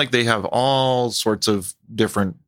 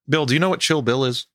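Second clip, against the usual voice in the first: plain reading voice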